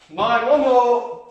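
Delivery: high, loud, drawn-out call; loud urging count. Real, not acted. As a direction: stretch it out to push the last effort.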